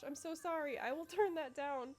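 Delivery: normal talking voice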